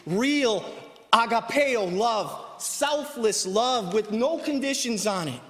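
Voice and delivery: loud, emphatic sermon delivery; forceful preacher with swelling conviction; fervent preaching. Acted. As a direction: proclaim it loudly to the whole church.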